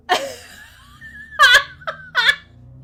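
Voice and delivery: very high loud wheezing laugh; long shrieking laugh. Real, not acted. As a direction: laugh until tears come. Filter still on.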